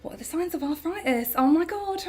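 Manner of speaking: a hint of health-anxiety panic; mock panic. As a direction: gasp it out in mock alarm. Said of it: "I go on Google and I'm like".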